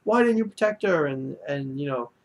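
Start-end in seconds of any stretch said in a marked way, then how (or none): 0.0-1.1 high-pitched imitation of an upset confrontation; retold accusation, pitched up and pleading for an answer; upset outburst. Upset boy confronting Shinichi after her death, mock-upset high cry. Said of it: "he was really upset now".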